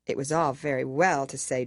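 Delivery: ordinary delivery, nothing marked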